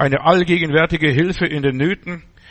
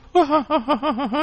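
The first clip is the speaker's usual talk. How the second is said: laugh; 9 semitones higher than usual. Real, not acted. Fond memory of babies.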